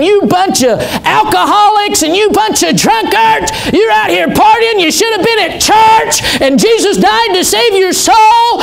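high, shouted hellfire sermon; building, ringing street-corner yell; belly whooping preaching. Retold or imitated. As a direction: bellow it at the bar crowd from the street corner. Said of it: "just starts belly whooping".

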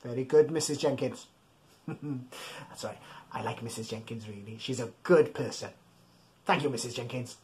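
low soft puppet voice; a quiet low voice for the puppet; lightly voiced puppet lady. Puppet voice of Missus Jenkins.

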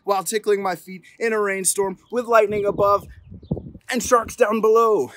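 rising storybook drama; building excitement. A narrator's reading. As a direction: swell up to the climax. Escalating cumulative refusal.

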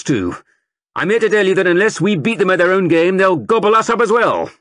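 loud, high recruiting sergeant rousing a crowd; sergeant major. A narrator's reading. Sergeant major rousing the market crowd, booming. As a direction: bellow it to the whole square like a drill sergeant.

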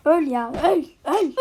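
"öl" yelled loud and slow. Real, not acted. Gamer yelling at an enemy to die, loud and slow. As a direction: bellow it at the enemy.

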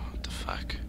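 unvoiced, uneasy whisper; hushed and afraid; scared whisper. Real, not acted.

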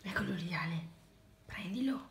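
soft unvoiced whisper. Real, not acted. Prompting child quietly.

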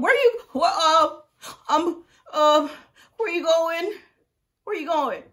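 high, loud, stammering alarm; acted panic. Sick wife as husband leaves the room, panicked. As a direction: cry out frantically, as if being left behind.

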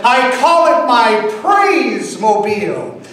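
the car owner preaching to a stranger, loud and high; zealous preacher. Retold or imitated. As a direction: shout it from the mountaintops. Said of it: "And he said".

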